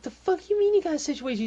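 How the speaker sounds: fast, loud, high-pitched retort; irate boss demanding an explanation; exasperated disbelief. Acted. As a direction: fire it back, incredulous and irritated. Boss in acted quarrel.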